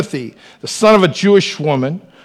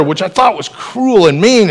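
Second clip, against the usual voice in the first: ordinary talk, nothing marked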